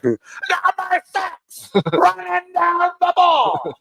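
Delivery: very high, slow hammed-up hype call; Gus Johnson hype. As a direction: squeal the call out, stretched long. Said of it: "It's not bad".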